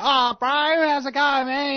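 high-pitched far north New Zealand greeting; imitated far north Kiwi accent, high voice. Shown as a demonstration. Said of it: "you have the far north New Zealand's".

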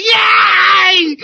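high, loud mock cheer; crowd cheering. Everybody else in the crowd cheering, high mock yay. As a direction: whoop like a gleeful crowd. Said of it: "Everybody else is going".

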